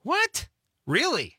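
high shocked "what?"; surprised. Real, not acted. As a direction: gasp in disbelief. Surprised at bingo prize.

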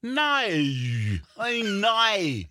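high, hammed-up Australian accent; mock Australian, stretching "no" into "noy". Comic imitating Australians saying no, high Australian accent. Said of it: "Australian people, when they say no".

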